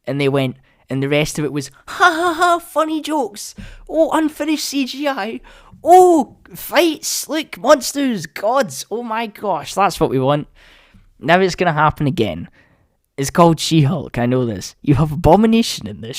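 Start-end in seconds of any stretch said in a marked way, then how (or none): 1.9-10.5 very high, hammed-up gushing; silly high-pitched mock enthusiasm; mocking fake delight. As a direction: squeal it in sarcastic excitement. Mocking the show's jokes and spectacle.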